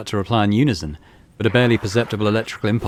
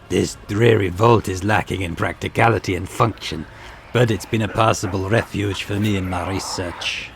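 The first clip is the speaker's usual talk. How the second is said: a lightly voiced disdainful scholar; haughty xenos voice. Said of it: "I don't know what accent that was at the end there".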